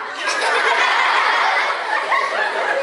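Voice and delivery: loud high burst of laughter; big laugh. Real, not acted. Teacher cracking up after the fake scream, high loud laugh. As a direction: laugh out at the silly demo.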